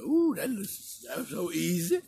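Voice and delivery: a high, slow, drawn-out delighted ooh; delighted surprise. Retold or imitated. Young [name] marvelling at his first easy drug sale, high and drawn out. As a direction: savor how easy it seemed, stretched out and high. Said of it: "I said".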